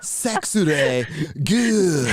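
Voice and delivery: very slow, suggestive drawl; sultry pun. Pretend. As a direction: stretch it out seductively. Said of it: "the very, uh, sensual plugs".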